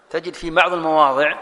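ordinary talk, nothing marked

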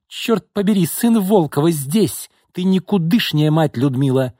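acted heated scolding; angry accusation. A narrator's reading. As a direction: lash out at your sister. Sisters' acted quarrel.

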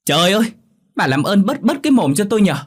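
high, loud cry of exasperation; acted daughter's angry outburst; exasperated yell. A narrator's reading. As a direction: yell at mother to stop, pitch way up. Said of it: "Hương gào lên".

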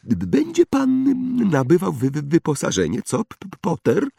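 high, jittery stutter; nervous high-pitched halting speech; frightened stammer. A narrator's reading. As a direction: stammer as if scared of the thought. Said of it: "Wyglądał na przerażonego samą tą myślą".

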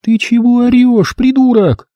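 high, startled wife's voice; a woken wife yelling back. Acted. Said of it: "Испуганно тараща сонные глаза".

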